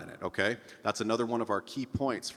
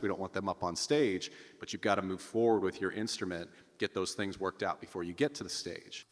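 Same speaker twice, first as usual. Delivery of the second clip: ordinary delivery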